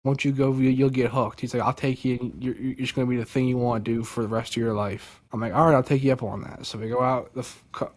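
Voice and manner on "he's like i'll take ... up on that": ordinary talk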